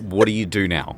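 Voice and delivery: normal talking voice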